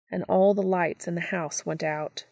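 usual speaking voice

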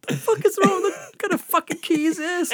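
very high, loud bewildered outburst; retold frantic exasperation. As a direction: cry out in hammed-up confusion at a useless object. Retold frantic fight with key.

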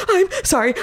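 high, tearful mock sorry; mock sob. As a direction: imitate a teary apology. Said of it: "started sobbing".

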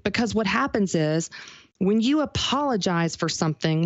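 usual speaking voice

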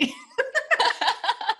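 high laugh. Real, not acted. Host laughs after teasing question.

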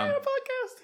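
giddy. Retold or imitated. Mocking their own excitement over the podcast, giddy squeal. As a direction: gush like a kid showing off. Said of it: "We all got giddy for the whole day".

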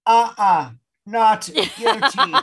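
high, loud, drawn-out comic refusal; mock denial. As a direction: deny it with hammed-up insistence. Continues the mock innocence plea.

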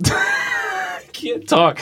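talking through a locked jaw; tight jaw. Shown as a demonstration. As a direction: lips held still, jaw clenched. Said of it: "Just talk without moving your lips".

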